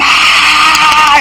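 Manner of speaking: long, high crowd yell; drawn-out high "aah"; imitated crowd scream. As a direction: mimic a screaming, cheering crowd. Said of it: "todos gritaron".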